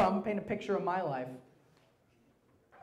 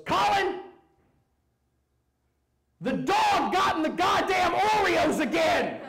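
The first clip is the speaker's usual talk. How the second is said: much higher, louder, slow enraged yell; angry dad shout; 12 semitones higher, 6 dB louder than usual. Retold or imitated. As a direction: roar at the son like a furious father. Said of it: "He goes".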